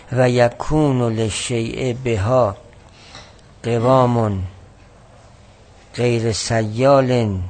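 loud, slow reading of the Arabic text; slow, loud recitation of the quoted passage; formal text reading. Acted. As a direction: read the classical text aloud, weighing each word. Reads Arabic source text aloud.

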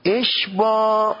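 اشباع stretched very slowly and high; drawn-out demo. Shown as a demonstration. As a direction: draw out اشباع long and slow. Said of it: "کالامالة والاشباع".

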